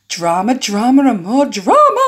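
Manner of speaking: theatrical relish, swelling to the end; hammed-up excitement. Character craving drama on camera, hammed-up excitement. Acted line of a game character.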